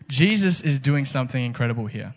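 ordinary talk, nothing marked

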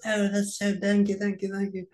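high, gushing camp thank-yous. Retold or imitated. Actor gushing his thanks, high camp voice.

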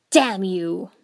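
loud, furious snarl; snarled curse. A narrator's reading. Stabbed Doto cursing Yukie, loud furious snarl. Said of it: "Doto snarled".

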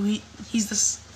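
ordinary delivery

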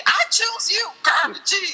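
high, heroic cartoon battle call; playful high anime-trainer cry; Pokemon trainer.

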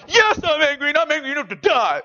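loud, high whiny sulk; pouting Jonah. Retold or imitated. Preacher imitates Jonah sulking at God, loud and whiny. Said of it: "picture Jonah pouting".

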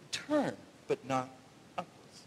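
low, dropping to almost nothing on upwards; hushed repeat. Shown as a demonstration. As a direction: savour the phrase softly. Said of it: "I love this phrase".

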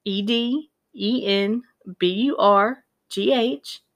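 slow, one letter at a time; spelled out letter by letter. Retold or imitated. Reading the misspelled street from the tape, slowly spelled. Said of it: "and he spells it out".

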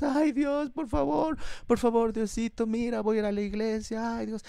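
high, whiny mock plea to God; mock pleading prayer. Preacher mocks a believer begging God in crisis, whiny pleading.

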